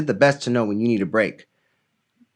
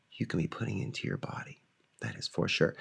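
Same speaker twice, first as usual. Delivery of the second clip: whispered aside about water; 3 semitones lower, 14 dB quieter, faster than usual. Real, not acted. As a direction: lean in and whisper it like a tip. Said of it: "whispered aside".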